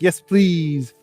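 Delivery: ordinary talk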